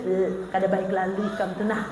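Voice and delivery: a low, soft, disdainful remark; older sister mocking her sibling's outfit, low and quiet; sister's put-down.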